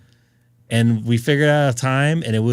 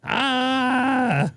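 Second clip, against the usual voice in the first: high, drawn-out "ah"; frustrated cry; 12 semitones higher than usual. Real, not acted. Streamer after hitting the wrong button, frustrated wail. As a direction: wail at your own fumbling.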